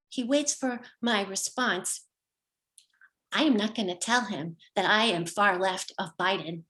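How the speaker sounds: ordinary talk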